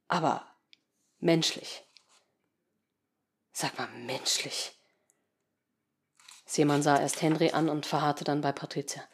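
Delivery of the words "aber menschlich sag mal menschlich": bitter murmur. Acted. Seemann bitter, disgusted.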